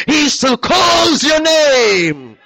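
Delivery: high, slow, drawn-out preaching cry; rising shout. Real, not acted. Preacher crying out to the congregation, slow soaring shout. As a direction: stretch the line into a soaring shout.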